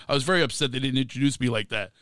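ordinary talk, nothing marked

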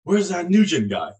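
loud, high bark of a name across the bus; retold drill instructor yell, pitched high and loud. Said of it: "He's yelling and screaming".

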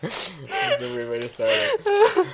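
giggling through the words; laughing. Real, not acted. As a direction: laugh while saying it. Laughing at awkward start.

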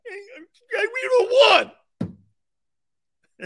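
high, loud whiny fan yelling shut up; sore Niner fan. Retold or imitated. Mocking a 49ers fan to his Eagles guest, high and whiny. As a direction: sulk and shout like a fan who lost.

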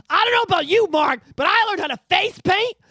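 loud, high-pitched outrage; indignant rant. Real, not acted. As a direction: erupt in loud disbelief at your co-host. Indignant rant to Mark after bit.

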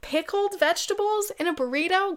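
high, grossed-out squeal; disgusted. Real, not acted. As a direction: recoil at the idea. Disgusted at pickled veggies.

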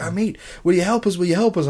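high-pitched pleading kid voice, mocking; imitating the kids. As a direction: pitch it up like a young kid begging for help. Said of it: "they're Irish".

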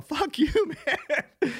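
high, drawn-out mock yell; comic re-enacted defiant cry; retold yelling. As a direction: hurl it back high and slow, laughing. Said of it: "I'm just yelling".

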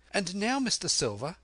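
spirited lad making his appeal; Jim, earnest and bold. Acted.

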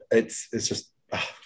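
words catching in the throat; choked up. Real, not acted. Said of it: "I got choked up talking about it".

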